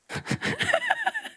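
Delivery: a high, squeaky burst of laughter; high squealing laugh. Real, not acted. Laughing at trolls eating people.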